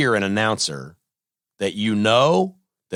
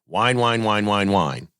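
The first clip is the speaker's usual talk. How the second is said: mock whining; 3 semitones lower, slower than usual. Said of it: "one of the whiniest characters ever".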